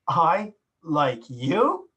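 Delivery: drawn-out, slow and sneering "I like you"; sarcastic. Shown as a demonstration. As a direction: let it drip with the opposite meaning. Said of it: "Same words, but the tone of voice".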